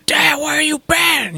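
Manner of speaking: high, drawn-out mock shout; pissed-off son. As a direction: imitate an upset boy yelling at his dad. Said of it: "he's pissed".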